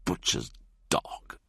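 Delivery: slow, low whispered sneer; sneering whisper at the clerk. Acted. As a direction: spit it quietly at the clerk. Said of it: "he sneers".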